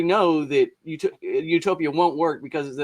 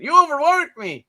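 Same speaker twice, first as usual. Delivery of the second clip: put-on AI voice in a much higher Indian accent; 13 semitones higher, 4 dB louder than usual. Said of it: "give A I all an Indian accent".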